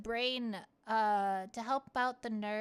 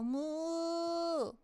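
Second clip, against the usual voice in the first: higher, drawn-out imitation of a cow's moo; mock cow moo; 5 semitones higher than usual. Saying hi to cows on the road with a playful moo. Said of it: "I go".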